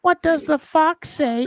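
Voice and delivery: slow squeaky high fox line; slow high-pitched mock fox voice; high squeal. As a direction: drag it out high and silly. Repeating fox song line.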